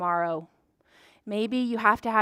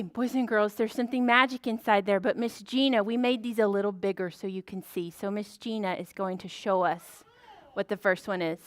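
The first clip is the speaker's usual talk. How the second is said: ordinary delivery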